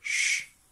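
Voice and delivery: a drawn-out, voiceless "ch"; "ch" held as a single hushing sound; isolated phoneme. Shown as a demonstration. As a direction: sound out "ch" alone, with no vowel. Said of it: "tous les sons qu'on entend".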